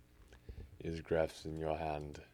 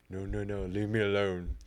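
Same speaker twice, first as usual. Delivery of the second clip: louder, higher frightened cry; hammed-up terrified protest; panicked plea; 4 semitones higher, 9 dB louder than usual. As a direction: beg the mice to stop. Attacked by mice, pleading.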